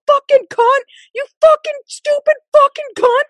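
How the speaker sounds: high-pitched mock yell; retold screaming. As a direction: imitate an enraged driver yelling out the window. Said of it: "screaming out his window".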